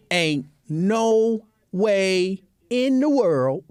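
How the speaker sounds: very slow, drawn-out emphasis on every word; emphatic refusal. Real, not acted. Refusing any pedicure from that man, slow and emphatic. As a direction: stretch out "no way in the world" adamantly.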